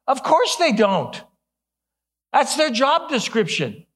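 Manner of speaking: high, loud comic exasperation; mock exasperation. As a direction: protest that it is utterly obvious. Mock exasperation at complaining fans.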